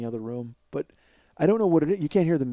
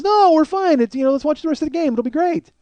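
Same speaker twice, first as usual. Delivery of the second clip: higher-pitched, upbeat host voice; reassuring husband; 11 semitones higher than usual. Retold or imitated. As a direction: imitate a husband insisting all is fine. Husband insists guests stay, retold.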